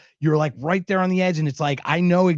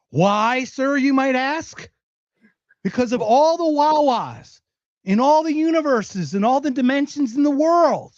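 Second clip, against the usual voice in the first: milk the suspense like a grand storyteller; mock-dramatic; 9 semitones higher than usual. Mock-dramatic build-up of his story.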